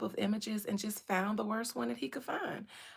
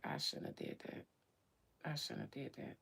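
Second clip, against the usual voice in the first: much softer, breathy regretful whisper; guilty whisper; 8 semitones lower, 12 dB quieter than usual. Real, not acted. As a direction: murmur the regret under the breath. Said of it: "okay, I shouldn't have did that".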